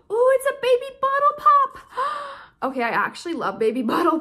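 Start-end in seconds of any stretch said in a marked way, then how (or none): 0.1-2.3 high, loud thrilled exclamation; real excitement, pitched way up; delighted squeal. Real, not acted. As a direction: squeal over the candy find. Finds favorite candy in package.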